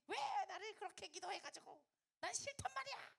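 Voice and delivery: put-on high-pitched whine of a quarrelling child; acted angry protest. Child arguing with mum over her prayer, mock-angry high protest. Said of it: "엄청 싸우겠다, 그지?".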